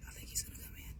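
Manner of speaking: unvoiced hushed whisper. Real, not acted. Waiting for the wasp to come in, whispering. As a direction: whisper so the wasp won't hear.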